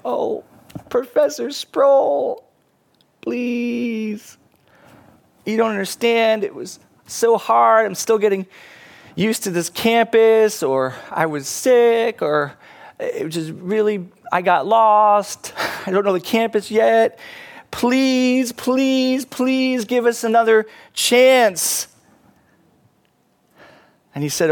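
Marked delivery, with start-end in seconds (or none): 0.0-10.6 high, drawn-out whining plea; hammed-up pleading, high and slow; begging. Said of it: "they're just begging". 11.1-12.2 high, drawn-out student excuse; hammed-up sorry plea; begging. Students pleading with their professor. 13.0-21.9 high, hammed-up whining plea; pleading student making excuses; begging. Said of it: "they're just begging".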